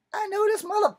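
sudden high, loud angry yelp; high, loud indignant cry; retold outburst. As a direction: shoot way up in pitch, outraged. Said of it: "And I was like".

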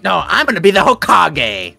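Naruto impression. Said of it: "you kinda sound like Naruto".

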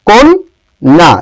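কন্যা very slow in two beats, "kon... na"; pronunciation demo. Shown as a demonstration. Teacher showing students how কন্যা is said, slowly split. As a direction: stretch কন্যা apart with a closed "o". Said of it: "কন্যা বলি".

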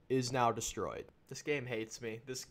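ordinary delivery

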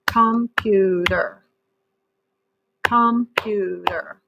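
computer split slowly into com-pu-ter; syllable by syllable. Shown as a demonstration. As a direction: clap out computer, one slow beat per syllable. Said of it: "let's see how many syllables".

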